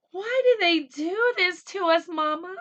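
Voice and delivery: put-on high, loud frightened little girl; child's high cry to her mother. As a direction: plead like a scared child. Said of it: "Anna cried to her mother".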